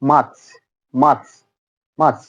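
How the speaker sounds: মার্চ said "maach" with no r; r dropped. Shown as a demonstration. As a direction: model মার্চ without the r. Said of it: "উচ্চারণ হচ্ছে". (আর ছাড়া মার্চ)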